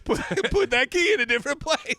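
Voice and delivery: mock ad pitch. Mock ad announcer.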